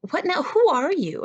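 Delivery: high-pitched, incredulous mock outburst; taken aback. Playwright to the stranger who just insulted him, taken aback. Acts out affronted playwright's reaction.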